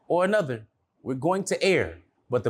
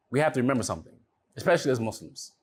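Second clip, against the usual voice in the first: ordinary delivery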